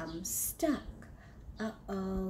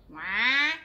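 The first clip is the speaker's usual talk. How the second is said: loud crash cry; 10 dB louder than usual. Acted. Crash noise in story.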